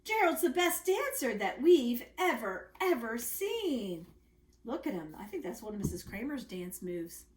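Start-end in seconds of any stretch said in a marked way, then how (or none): 0.0-4.1 amazed shout. Acted. Animals shouting in amazement at Gerald's dancing. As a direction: call it out, amazed. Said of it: "They shouted".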